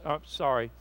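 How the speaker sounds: ordinary delivery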